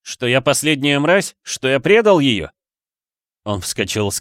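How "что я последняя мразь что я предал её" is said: heated, higher-pitched accusing questions; furious. A narrator's reading. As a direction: throw the questions out in rage. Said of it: "Её сожрала ярость". (с яростью, на повышенных тонах)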